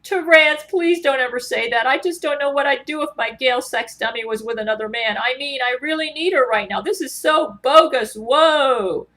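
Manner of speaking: a hint of Keanu, upset. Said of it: "Here's Keanu".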